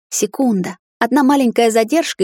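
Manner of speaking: plain reading voice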